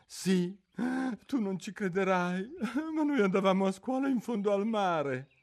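high, mournful creature voice; a sorrowful old turtle reminiscing; mock turtle voice. Mock turtle telling Alice about school under the sea, mournfully.

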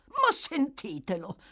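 high, incredulous exclamation; scornful exclamation. A narrator's reading. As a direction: shrug it off with mock amazement. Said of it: "esclamò l'ebreo, facendo spallucce".

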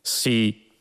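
mildly patronising yes; light forbearance. A narrator's reading. Computer tolerates two fools, lightly patient. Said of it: "col tono di chi sopporta a cuor leggero gli sciocchi".

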